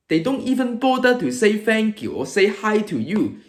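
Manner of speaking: ordinary talk, nothing marked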